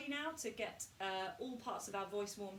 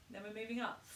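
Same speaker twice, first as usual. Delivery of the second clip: normal talking voice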